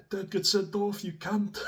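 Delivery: high-pitched mock coach warning; imitating the coach. Coach on the sideline warning the player, high mock voice. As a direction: pitch way up, a pleading coach's plea. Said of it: "he's there going".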